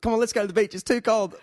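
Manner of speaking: high, loud whingeing wife; whiny wife voice. Retold or imitated. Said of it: "that's what I call my wife".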